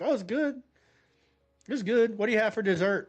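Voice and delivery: high-pitched, breezy approval; quick change of tone. Retold or imitated. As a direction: play it off as totally fine. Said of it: "I changed my tone really quick".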